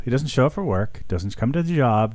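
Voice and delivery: ordinary delivery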